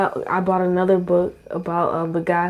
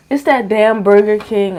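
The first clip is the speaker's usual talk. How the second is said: ordinary delivery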